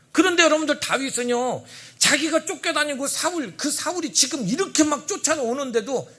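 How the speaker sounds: loud, high preaching build; fervent pulpit voice; forceful preaching. Real, not acted. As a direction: preach it out to the congregation.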